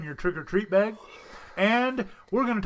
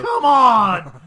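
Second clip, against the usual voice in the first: loud high exasperated yell; loud exasperated shout; 8 semitones higher, 13 dB louder than usual. Real, not acted. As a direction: bellow it in frustration. Host upset at smeared drawing.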